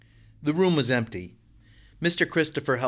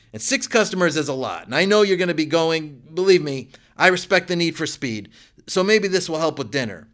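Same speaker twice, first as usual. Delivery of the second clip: higher and louder, eager and upbeat; brightening up; 6 semitones higher, 7 dB louder than usual. A narrator's reading. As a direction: cheerfully win them back from the kitchen. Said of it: "Brightening up".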